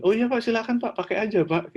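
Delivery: quick, high, obliging yes; retold instant consent. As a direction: blurt a deferential go-ahead, pitched high. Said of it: "Saya nggak pakai pikir panjang, langsung bilang".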